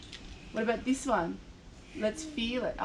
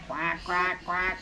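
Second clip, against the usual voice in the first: duck quacking; 6 semitones lower, slower than usual. Acted. Voicing the duck.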